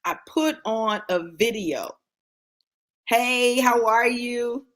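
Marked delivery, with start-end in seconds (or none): 3.1-4.7 higher, louder, drawn-out hello; bright greeting. Real, not acted. Breaking off the story to greet someone, raised drawn-out hello. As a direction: greet them in a raised, stretched-out voice.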